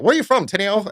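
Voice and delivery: lightly voiced careless owner; mock Rick voice. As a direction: play the distracted owner brushing off staff. Voicing the owner Rick's question.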